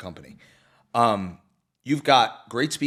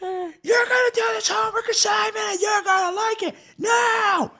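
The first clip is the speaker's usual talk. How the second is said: slow, much higher screaming rant; Sam Kinison scream; 4 dB louder than usual. Retold or imitated. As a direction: scream it like a furious comic teacher. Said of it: "Kinnison's just screaming".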